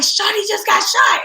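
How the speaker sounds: high, slow panicked voice; frantic caller. Retold or imitated. Relay the news in a high panicked voice. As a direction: caller on the phone telling her about the shooting, high and panicked. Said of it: "They like".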